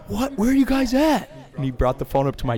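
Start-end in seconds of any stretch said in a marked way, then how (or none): 0.1-1.3 high, loud mock surprise with a laugh; brother startled. Brother answering the stranded friends' call, high startled voice. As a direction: play the brother baffled and pitched way up. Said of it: "he's like".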